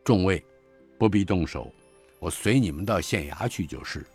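officer calmly yielding to the constables, soft; calm surrender. Acted. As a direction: give yourself up quietly and steadily. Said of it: "那军官道".

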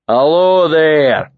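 loud northern hello that carries through the room; loud showy greeting. Acted. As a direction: boom it for the whole bar. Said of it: "he said loud enough for everyone in the bar to hear".

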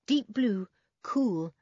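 plain reading voice

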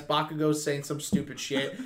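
normal talking voice

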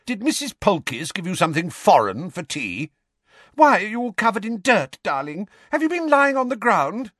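high, fretful Aunt Petunia voice; a high, fussing mother doting on her son. A narrator's reading.

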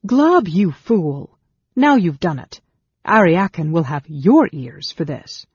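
loud, exasperated dressing-down; angry scolding. A narrator's reading. Scolds goblin and threatens him.

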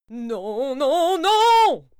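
the bear bellows no; building furious bear shout; bear yelling. Acted. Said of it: "hurla monsieur Ours".

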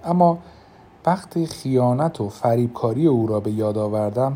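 usual speaking voice

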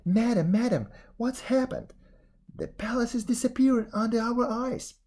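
high, loud frightened cry. A narrator's reading. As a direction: cry out in panic.

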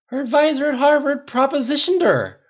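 high-pitched indignant shout; outraged cry. A narrator's reading. Rhodey venting about his girlfriend's advisor, outraged cry. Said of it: "Rhodey cried".

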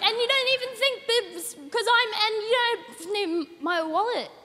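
high-pitched exasperated rant at the cop; frustrated rant. Retold or imitated. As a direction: rant at him, voice climbing high. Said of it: "I got really frustrated".

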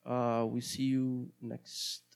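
ordinary talk

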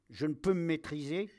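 plain reading voice

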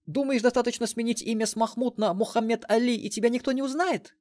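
high-pitched female telling-off; scornful retort. A narrator's reading. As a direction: challenge him in a high, indignant voice. Мелисса rounds on Махмуд. (возмущённая отповедь высоким женским голосом)

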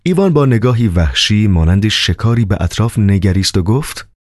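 plain reading voice

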